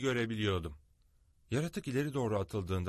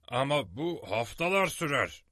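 higher-pitched, whining protest; complaining; 5 semitones higher, 3 dB louder than usual. A narrator's reading. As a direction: object in a put-out, raised pitch. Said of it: "diye şikayet etti Summerlee". (tiz sesle, şikayet ederek)

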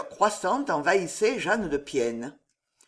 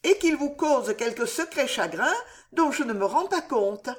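anxious pleading; 7 semitones higher, 3 dB louder than usual. Acted. Frightened daughter pleads with mother.

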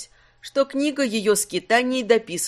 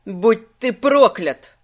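slow, louder curse; angry curse; 6 dB louder, slower than usual. Acted. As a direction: spit the curse out slowly. Curses unseen watcher.

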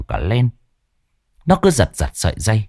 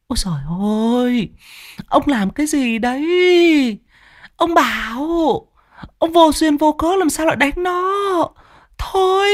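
very high woman's voice, alarmed outcry; a mother rushing in to stop it; mother crying out. Acted. Mother cries out at her husband to stop beating their son, high alarmed woman's voice. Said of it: "hô lên".

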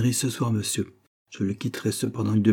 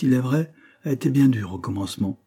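ordinary delivery, nothing marked

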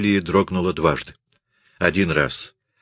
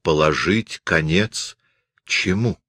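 ordinary delivery

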